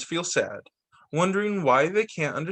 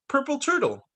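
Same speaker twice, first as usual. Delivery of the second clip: playful high voice; 9 semitones higher than usual. Acted. Child playing guessing game.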